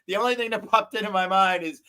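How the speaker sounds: ordinary delivery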